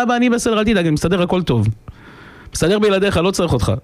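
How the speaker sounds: high-pitched, dismissive reassurance; carefree boy. Retold or imitated. Straying son answers his father, carefree. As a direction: play the cocky child needing nobody.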